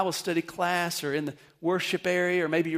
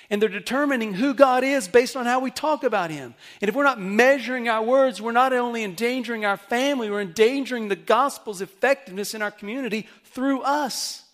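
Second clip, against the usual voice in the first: louder and higher, driving sermon build; full-force preaching; 7 semitones higher, 7 dB louder than usual. Acted. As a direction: preach it hard to the congregation.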